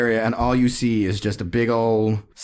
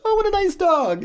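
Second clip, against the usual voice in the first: high, sugary "nice dog"; much higher, cooing over the dog; mock sweet kid voice. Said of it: "we were all, like".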